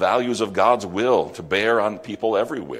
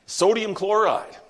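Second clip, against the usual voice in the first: emphatic naming; 12 semitones higher, 3 dB louder than usual. Real, not acted. Preacher names salt emphatically.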